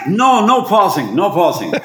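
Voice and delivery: high, insistent mock shout; director's yell, acted out; retold shouted order. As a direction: cut in with an urgent command. Re-enacts the director's barked order.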